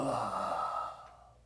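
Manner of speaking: long, drawn-out soft curse; hushed dread. Retold or imitated. Realizing he alone smoked, hushed sinking dread. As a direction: let the swear leak out slowly under your breath. Dread as everyone else says no.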